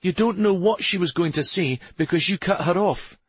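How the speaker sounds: high-pitched exasperated scolding; irritated woman pressing her complaint home; snapping back. A narrator's reading. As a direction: tell someone off, pitch held high. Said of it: "Shari snapped back".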